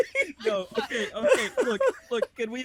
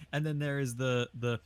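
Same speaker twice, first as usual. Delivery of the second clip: ordinary talk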